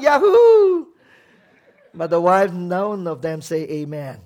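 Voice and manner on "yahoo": high, loud laughing whoop; cheering yahoo. Acted. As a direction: whoop with glee like a delighted husband. Husbands cheering at the verse.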